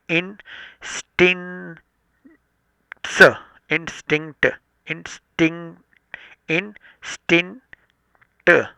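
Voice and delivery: इंस्टिंक्ट slow, with n-c-t said apart; sounding out instinct. Shown as a demonstration. Teacher sounding out इंस्टिंक्ट to separate the cluster. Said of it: "एनसीटी अलग से बोलना है".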